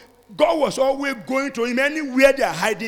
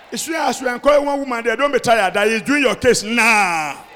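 ordinary talk, nothing marked

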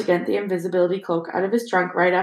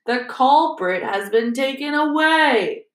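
very slow, over-enunciated announcement, pitched up; slow condescension; 4 semitones higher, 4 dB louder, much slower than usual. A narrator's reading. Lockhart explaining to the class, slowly and patronizingly. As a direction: spell it out for the dim-witted. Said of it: "speaking slowly, as though they were all a bit dim".

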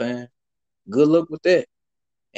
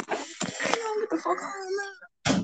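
mock high voice; 7 dB quieter, slower than usual.

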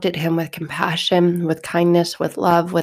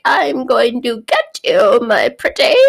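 higher, slow cackling witch threat; slow witchy threat to Dorothy; Wicked Witch voice; 4 semitones higher than usual. Retold or imitated. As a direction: menace Dorothy like a storybook witch. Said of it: "and she's like".

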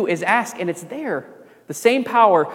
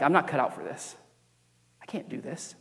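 broken whisper; 4 semitones lower, 9 dB quieter than usual. Retold or imitated. Crying prayer in bathroom.

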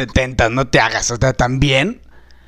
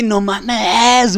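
ordinary talk

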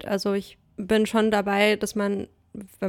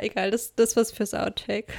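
normal talking voice

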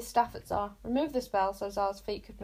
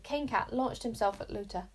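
ordinary delivery

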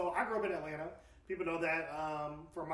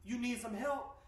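a hint of a pushy, higher attendant voice; gas station attendant; 8 semitones higher, slower than usual. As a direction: lightly voice the clerk calling after him. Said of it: "He said".

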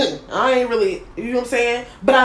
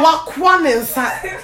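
mock African accent, louder, higher and drawn out; 6 semitones higher, 5 dB louder, slower than usual. Imitating his mom for a friend, exaggerated African accent. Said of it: "almost so they're African".

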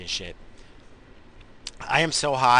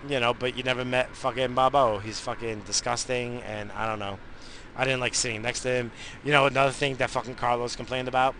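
usual speaking voice

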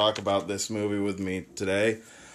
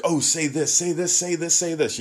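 higher-pitched imitation of eager requesters; mock pestering; 6 semitones higher than usual. Host imitates people asking accented speakers for words, mock eager. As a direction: nag playfully, repeating it like an excited crowd. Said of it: "other accents, they get to, you know, like".

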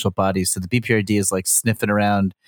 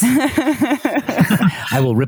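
Evil Dude voice; 3 semitones higher than usual. Pretend. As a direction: gloat it out like a movie supervillain. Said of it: "I am evil".

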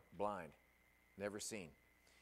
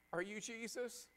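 put-on girl's tearful question; 9 semitones higher, 4 dB quieter than usual. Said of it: "she's just weeping and bawling".

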